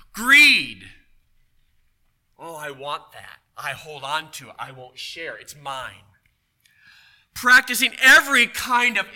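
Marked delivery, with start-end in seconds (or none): 2.4-6.1 greedy voice. Retold or imitated. Voicing a greedy person.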